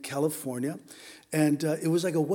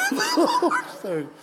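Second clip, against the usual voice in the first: laughing; 3 dB louder, slower than usual. Real, not acted. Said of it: "everyone laughed".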